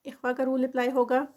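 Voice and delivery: ordinary talk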